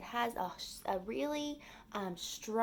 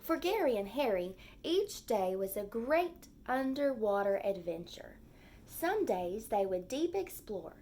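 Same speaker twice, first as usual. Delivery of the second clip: gentle storybook read-aloud; lively story narration for young kids; reading to children. A narrator's reading. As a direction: read it like a picture book for the class. Teacher reads picture book aloud.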